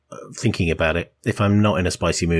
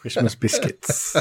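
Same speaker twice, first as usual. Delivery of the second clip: laughing while speaking; 3 semitones higher, 4 dB quieter, slower than usual. Real, not acted. Laughing at the biscuit joke.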